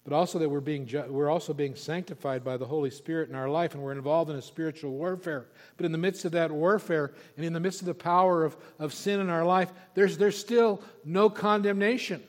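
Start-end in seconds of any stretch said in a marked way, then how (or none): 5.8-12.2 louder, rising preacher's push, slowing on no condemnation; preaching at full force. Acted. Preacher driving home no condemnation, fervent. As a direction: build the line up and land it hard.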